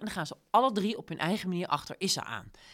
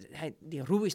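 normal talking voice

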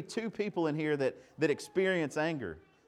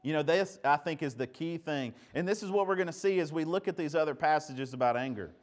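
normal talking voice

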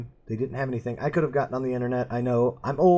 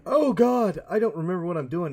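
high startled oh God, then quick; panicked blurt of forgetting; dismayed oh God; 6 semitones higher than usual. Retold or imitated. Gamer back after a month, startled. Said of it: "went back and went".

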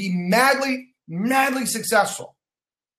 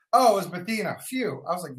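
ordinary delivery

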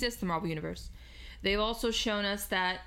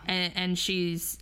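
normal talking voice

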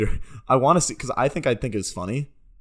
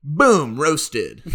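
higher, loud and proud; triumphant mock roast; 7 semitones higher, 5 dB louder than usual. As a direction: shout the punchlines triumphantly.

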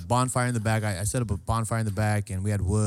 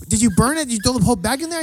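high, loud horrified disbelief; mock alarmed questioning; 12 semitones higher, 6 dB louder, faster than usual. Host questioning cousin about burned bag, mock alarmed. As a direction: demand it in comic panic.

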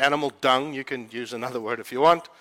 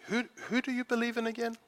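higher and much softer, amazed; retold hushed amazed question; hushed awe; 8 semitones higher, 8 dB quieter than usual. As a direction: ask it softly in awe. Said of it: "she literally says to me".

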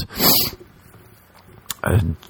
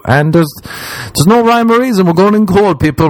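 high, wired coffee energy; bouncing off the walls on coffee; caffeinated; 11 semitones higher than usual. Real, not acted. Podcaster wired on coffee, high excited voice. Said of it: "As you can tell now, I've just drank coffee".

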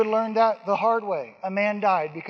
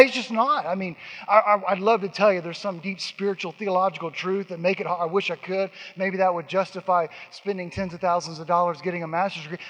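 normal talking voice

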